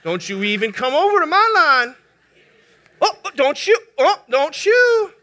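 loud, rising territorial warning; hammed-up affronted driver warning someone off; mock offended. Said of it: "we are deeply offended".

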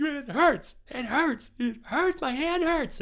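high, loud repeated whine; whining. Retold or imitated. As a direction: whine it like a hurt child. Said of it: "And I'm like".

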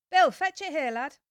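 high, loud call up to Bill. Acted. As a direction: shout across the yard to the lad. Worker calling to Bill.

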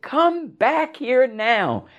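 high, slow commanding call; imitating mother's screamed order; mock yell. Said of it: "she's yelling and she's screaming".

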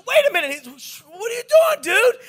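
high, loud retold outcry; hammed-up startled complaint; alarmed protest. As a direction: yelp it in mock outrage. Retells dodging thrown bedpans.

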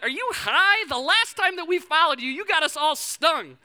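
put-on high, loud incredulous child's voice; incredulous kid. Wary kids answering the counselor, incredulous. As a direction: scoff like a wary little kid.

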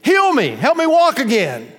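high, loud pleading cries to God; yelling out. Acted. Blind man begging the Lord for healing, yelling out. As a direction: cry out desperately in short bursts. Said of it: "yell out to God".

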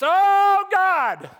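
sudden high, loud panicked cry to God; desperate cry. Retold or imitated. Preacher imitating a man in mortal danger crying out in panic. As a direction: blurt it out like you're falling. Life-or-death panic prayer.

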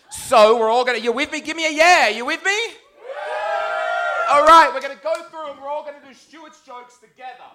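high, loud rallying call; hyping the crowd. Real, not acted. Comic getting the audience to shout yeah, loud hype. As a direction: pump up the room like a concert host. Rousing crowd for stand-up karaoke.

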